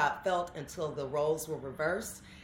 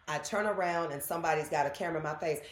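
usual speaking voice

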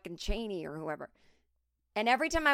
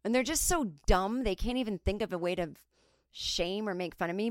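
ordinary delivery

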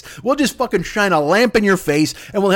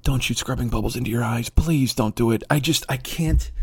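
lower, softer, begging plea; pleading Fauci; 8 semitones lower, 4 dB quieter than usual. Retold or imitated. Fauci pleading with the public after the briefing, lower, softer begging. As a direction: beg them quietly not to do it. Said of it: "Fauci scrambles to the microphone".

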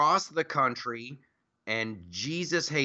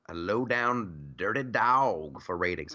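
LOW-DOWN and DOG slowly drawn out; emphatic repeat; 5 semitones lower than usual. Real, not acted. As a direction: stretch out "low-down", then land lower on "dirty dog". Repeats the insult for effect.

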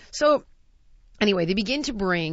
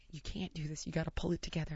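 very soft, lower-pitched urgent aside; hushed scolding; 4 semitones lower, 15 dB quieter, faster than usual. Retold or imitated. Dining partner steadying the panicker, hushed retold scolding. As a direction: scold under your breath so nobody overhears.

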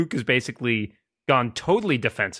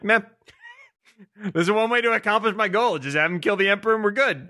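higher, offhand mock shrug; shrugging nonchalance; 9 semitones higher than usual. Vader shrugs off the Emperor, higher offhand mock voice. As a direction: toss it off like no big deal. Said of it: "So what if he'd just been like".

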